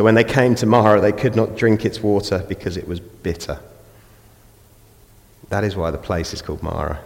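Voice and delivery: ordinary talk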